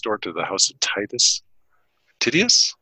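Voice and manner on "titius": ordinary delivery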